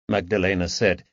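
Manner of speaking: usual speaking voice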